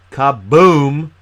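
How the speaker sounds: big drawn-out loud kaboom; booming exclamation. Shown as a demonstration. Youth trainer selling the impact of remembering names, booming exclamation. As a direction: boom it out, stretching it long.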